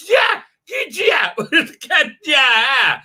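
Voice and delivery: high, loud, laughing yeah-yeahs; excited yeah-yeah. Retold or imitated. Man walks in with his iPad, excited high yelps. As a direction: mimic an eager guy bursting in with his iPad. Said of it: "him walking in with his iPad going".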